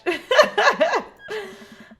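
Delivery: short high burst of laughter. Real, not acted. Dad watching cooking show, bursts out laughing. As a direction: laugh at the idea of himself cooking.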